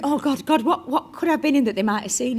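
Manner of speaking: loud, high, laughing panic; mock desperation. Actress recalling cab drivers quizzing her on roles, mock desperation. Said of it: "you get really desperate and you're going".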